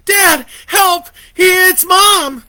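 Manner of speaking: high loud desperate plea; frantic pleading. A narrator's reading. Boy begging his father to save him, frantic. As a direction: beg your father for help, terrified. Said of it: "he pleaded with his dad".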